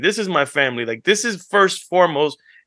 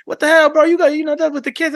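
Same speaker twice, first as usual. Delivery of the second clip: mock outraged friend; 13 semitones higher than usual. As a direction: imitate the friend's outraged pushback. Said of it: "Bleak chime in like".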